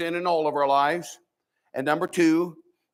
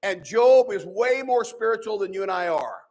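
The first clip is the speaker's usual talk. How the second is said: ordinary talk, nothing marked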